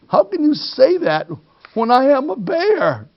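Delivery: high, disbelieving plea; incredulous protest. Acted. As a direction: protest in shock.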